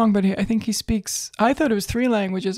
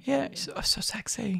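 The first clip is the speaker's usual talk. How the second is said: swooning whisper; 8 dB quieter than usual. Acted. Friend swooning over young [name], whispered. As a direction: whisper it like a lovestruck fan.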